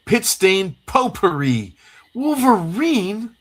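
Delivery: high-pitched, drawn-out mock outrage; exaggerated comic-book teen. Host voicing Jubilee's complaint, high and exaggerated. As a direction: stretch each word up high, hammed up.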